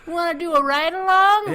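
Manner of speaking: very high, very loud put-on offer; over-the-top high-pitched mock offer; mock eager cop. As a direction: squeal the invitation in a jokey cop voice. Mock police inviting a witness along.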